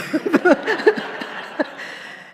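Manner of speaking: high breathless laughter at herself; real laugh. Real, not acted. Minister laughing while retelling a harsh sermon review. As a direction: laugh it off warmly, airy and high. Recalling catching herself mid-reaction.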